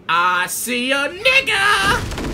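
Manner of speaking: high, drawn-out gleeful yell; excited shout. Real, not acted. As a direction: shout it high and slow on spotting a target. Spots an opponent in game.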